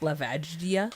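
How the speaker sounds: La Vagdia with a soft "vadge" in it; slow mock correction, La Vagdia as "la VADGE-dia"; joke mispronunciation. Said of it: "It's pronounced".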